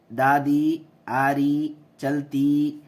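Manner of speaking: دادی آری چلتی with a clear long ی; long ی demo. Shown as a demonstration. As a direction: show the ی sound clearly. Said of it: "کیسے آواز آ رہی".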